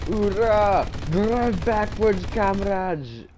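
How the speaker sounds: high, loud, slow rallying cry; rally the troops, drag it out; loud gung-ho soldier. Pretend. Cheering comrades in game.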